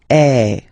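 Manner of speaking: eh said as a wide open vowel; open eh sound. Shown as a demonstration. Teacher modelling the open French eh vowel for learners. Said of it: "really open eh sound".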